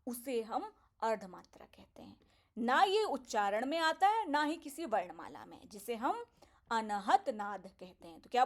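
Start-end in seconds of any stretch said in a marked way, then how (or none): 0.1-2.2 hushed whisper for subtlety; soft whispered explanation. Acted. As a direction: whisper the word as something subtle.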